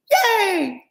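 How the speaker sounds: very high squealed yay; long, high cheer in a put-on comic voice; Target lady. Reader mimicking Saturday Night Live, high Target lady cheer. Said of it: "I'm like the Target lady".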